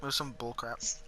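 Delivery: usual speaking voice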